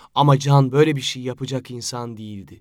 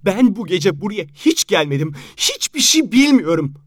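sobbing; 4 semitones higher than usual. Acted. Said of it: "dedi Işıl hıçkırıklarının arasında".

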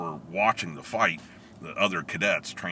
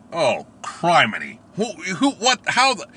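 panicked; 9 semitones higher, 6 dB louder than usual. Retold or imitated. Panicked inner reaction.

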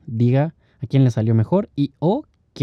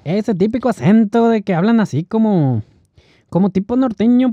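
ordinary delivery

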